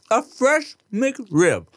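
slow, high savoring of each word. Real, not acted. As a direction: draw it out like a treat. Savoring the sandwich.

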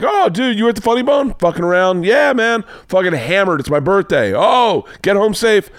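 high, rowdy buddy greeting; boisterous, high-pitched retold exchange; excited banter. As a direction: whoop it up like drunk pals. Acts out merry exchange with cops.

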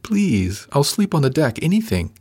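high-pitched, desperate begging; beg, pitch climbing toward the end; desperate pleading. A narrator's reading. Crew member offering anything to stay, desperately pleading. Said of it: "There was a desperation in her voice".

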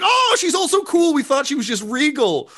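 very high, excited crowd voice; impressed crowd. Acted. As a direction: gush like an amazed onlooker.

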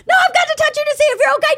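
high, racing frantic insistence; rapid-fire retold shriek; screaming. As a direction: imitate a panicked dad yelling fast. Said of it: "He was screaming at me".

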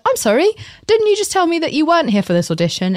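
high, incredulous challenge with rising end; indignant confrontation. Retold or imitated. Auditioner challenging the girl who took her slot, indignant.

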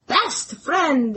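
put-on a quick echo of "best friend" in a clumsy Scottish accent; bad Scottish accent. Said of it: "that was the worst Scottish accent ever".

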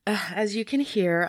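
hoarse, worn-out voice. Real, not acted. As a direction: speak with a voice gone from singing. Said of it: "I have no voice".

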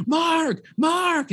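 high, drawn-out shout of a name, retold; calling out. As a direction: imitate a high-pitched call for someone. Retelling friends calling him over.

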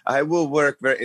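parroting a memorized English phrase; hint of a Montreal French accent. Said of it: "programmed answer that she was going to say".

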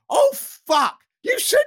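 sudden high startled curse; shocked yelp. Retold or imitated. Startled reaction, high and loud.

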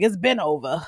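Tommy's voice. Retold or imitated. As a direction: drop into his flat tough-guy tone. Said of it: "that wonderful voice of his".

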